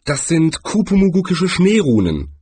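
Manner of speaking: lightly voiced high wizard; wizard stating a finding. A narrator's reading. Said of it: "stellte Rincewind fest".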